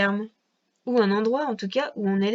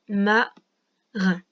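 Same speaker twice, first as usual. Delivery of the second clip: "Marin" slowly split, ma, long pause, rin; long gap inside "Marin"; syllable-by-syllable dictation; 3 semitones lower than usual. Shown as a demonstration. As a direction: check "Marin" one syllable at a time. Relit le mot par syllabes.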